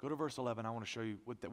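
usual speaking voice

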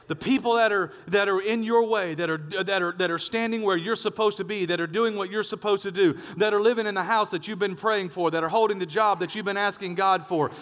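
forceful, insistent preacher's delivery; preaching. Real, not acted. Preacher telling the congregation God will clear their way, forceful preaching. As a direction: build the list and drive the promise home.

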